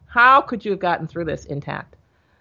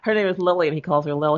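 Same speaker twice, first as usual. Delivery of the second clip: normal talking voice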